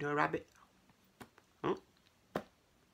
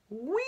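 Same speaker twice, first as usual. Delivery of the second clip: long rising high whee; a drawn-out "whee" sliding upward; gleeful whee; 14 semitones higher than usual. Acted. Storyteller acting out something flying through the air, gleeful high whee. Falling ice cream sound effect.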